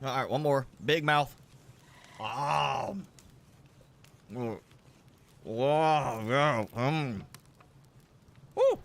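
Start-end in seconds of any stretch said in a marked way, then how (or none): none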